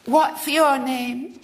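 put-on high, slow childish lisp; lisping little girl. A narrator's reading. Little girl asks William's name, lisping high and slow. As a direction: lisp it like a spoilt small girl. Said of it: "She lisped".

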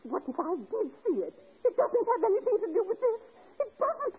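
high, laughing into crying; breaking into tears. Acted. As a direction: voice cracking into a sob. Breaks down, denial.

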